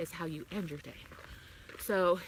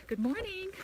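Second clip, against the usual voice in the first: cheerful greeting; 12 semitones higher than usual. Real, not acted. Greets a passer-by on walk.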